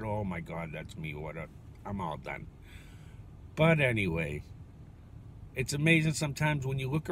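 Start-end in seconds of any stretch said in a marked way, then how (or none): 0.0-2.5 quiet, deflated self-talk; a hushed retold "that's me" moment; dismayed mutter. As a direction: let it sink, barely voicing it. Retold dismay on seeing himself.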